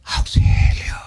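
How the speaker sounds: voiceless whispered plea for help; a faint cry for help from the pit. Acted. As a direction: breathe out a desperate call. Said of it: "así que viene".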